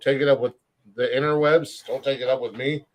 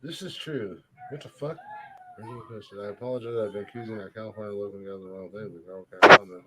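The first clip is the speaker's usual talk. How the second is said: much softer reading to himself; muttering; 6 semitones lower, 7 dB quieter than usual. Real, not acted. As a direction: mumble it under your breath. Muttering while reading screen.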